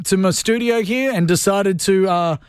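ordinary talk, nothing marked

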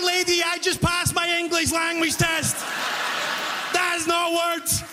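fed-up cashier pleading with the lady; exasperated mock yell. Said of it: "And I'm like".

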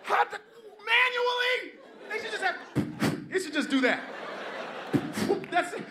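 high shrieking panicked rant; frantic passenger. Retold or imitated. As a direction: scream in disbelief while struggling with the vest.

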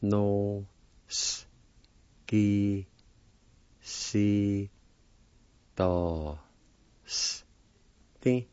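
no, s, ki, si, to, s, ti, each said as a separate syllable with pauses. Shown as a demonstration. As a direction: break the word into single syllables. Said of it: "It's pronounced like this".

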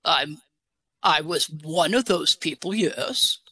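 put-on English accent voice, a bit deep. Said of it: "Where did you get your English accent?".

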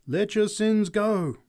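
slow, high and loud appeal; a preacher imploring sinners; crying out. Acted. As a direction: call out to the whole crowd, drawn out. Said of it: "she cried".

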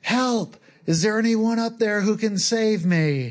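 high, pleading cry upward; mock scream for help. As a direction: shout up to the top of the cliff in mock panic. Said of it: "and he screams".

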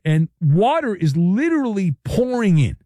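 usual speaking voice

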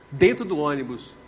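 normal talking voice

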